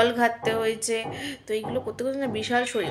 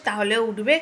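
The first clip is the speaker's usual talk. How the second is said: normal talking voice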